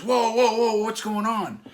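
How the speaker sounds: high, loud "whoa" held back slowly; alarmed calming. Retold or imitated. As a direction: rein everyone in. Willie calms the scene.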